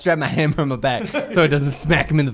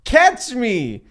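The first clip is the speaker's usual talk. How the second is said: excited; 6 semitones higher, 4 dB louder, slower than usual. Pretend. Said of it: "I go".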